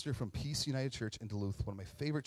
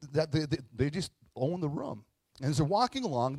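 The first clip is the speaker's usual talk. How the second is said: ordinary talk, nothing marked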